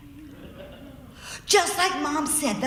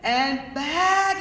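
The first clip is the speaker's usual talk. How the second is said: ordinary delivery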